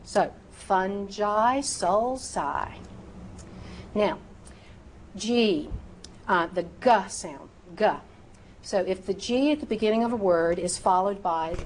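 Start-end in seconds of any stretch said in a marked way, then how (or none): 0.5-2.7 "fungi" as "fun-jai", "sulci" as "sul-sigh"; soft G and C. Shown as a demonstration. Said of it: "It is correctly fungi". 6.6-7.9 "G" said as "guh"; hard G sound. Shown as a demonstration. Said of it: "Use the hard G sound".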